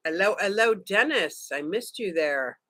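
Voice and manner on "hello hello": ordinary talk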